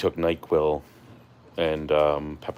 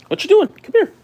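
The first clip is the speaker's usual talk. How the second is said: talking to a dog; 10 dB louder than usual. Real, not acted. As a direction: squeal it at the pet. High voice to dog.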